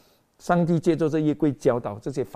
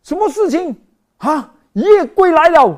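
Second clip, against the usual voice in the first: much higher, louder cry of alarm; alarmed shout; 13 semitones higher, 11 dB louder than usual. Acted. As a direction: Philistine soldier warning his camp. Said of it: "他讲". (惊慌大喊)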